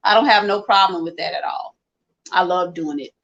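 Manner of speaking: normal talking voice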